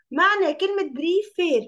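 normal talking voice

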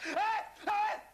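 loud high mocking demand; taunting shout. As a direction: bark it at her. Son taunts captive mother.

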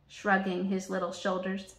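ordinary delivery, nothing marked

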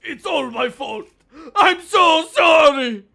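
loud, high put-on monster voice; monster customer voice. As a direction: play a boisterous cafe monster.

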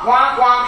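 high, loud imitated alarm quack; playful alarm imitation; mock alarm noise. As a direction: squawk it like a beeping alarm. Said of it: "Una alarma ha sonado".

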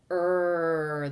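"Er" as one long held r-coloured vowel; isolated er sound. Shown as a demonstration. Teacher gives the answer, "Er" held long by itself. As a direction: sustain "Er" evenly. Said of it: "You hear the er sound".

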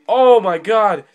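high, slow startled cry; shocked. Real, not acted. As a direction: let it burst out in alarm. Near death of teammate.

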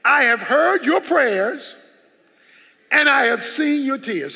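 "I have heard your prayers" drawn out slowly, each word weighted; slow it right down and lean on every word; deliberate, measured restatement; slow, emphatic repeat. Shown as a demonstration. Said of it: "same words repeated, slowed".

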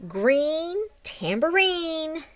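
normal talking voice

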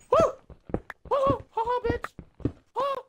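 high triumphant taunting; gloating. Real, not acted. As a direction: crow over your friend in victory. Survives, taunts friend.

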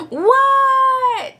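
very high, long-drawn holler; high-pitched, stretched-out kid's yell; yelling back. A narrator's reading. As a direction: yell back to a calling mother. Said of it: "yelled Froggy".

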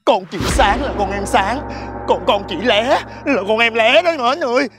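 pleading. Acted. Begging Mến to spare her.

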